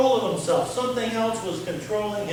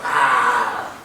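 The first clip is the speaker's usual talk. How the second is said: demon yell; 8 semitones lower, 4 dB louder than usual. Retold or imitated. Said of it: "So they tied him up and go".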